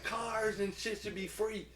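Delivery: slow, very high alien drawl; country alien dude. Retold or imitated. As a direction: lay out the alien's big idea slowly and squeaky. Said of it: "a little country ass, uh, alien dude".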